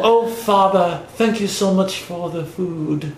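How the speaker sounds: high, slow fake British child; thankful little British child, drawn out; British child accent. Shown as a demonstration. Host demonstrating for co-hosts, thankful British child accent. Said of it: "British child accent".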